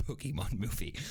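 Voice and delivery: ordinary delivery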